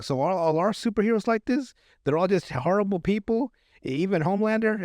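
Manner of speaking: high, shaken questions, pitch up; retelling a kid stunned that heroes are bad; high disbelieving questions; shocked disbelief. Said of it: "his whole world's been shattered".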